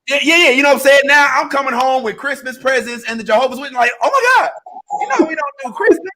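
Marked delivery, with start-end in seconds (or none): 4.0-6.1 high appalled church voice; mock shocked relative; mock scandalized relative. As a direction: gasp it up high like a shocked in-law. Mocking Jehovah's Witness family.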